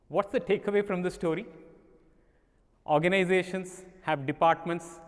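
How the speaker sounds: ordinary talk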